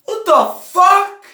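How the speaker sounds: high, loud, slow squeal of disbelief; squealed outrage. Real, not acted. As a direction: squeal it like the game betrayed you. Outraged squeal at game sequel.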